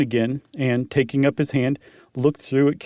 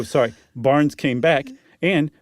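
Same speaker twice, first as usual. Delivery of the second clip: usual speaking voice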